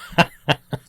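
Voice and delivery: high burst of laughter; real laugh. Real, not acted. Host amused by own Poms joke, bursting out laughing. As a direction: crack up at your own joke.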